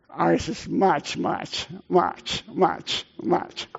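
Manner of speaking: playfully piling up "much" before the punchline; comic repeated much. Real, not acted. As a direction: stretch out "much, much, much" for a joke. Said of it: "I could use a lot of muches".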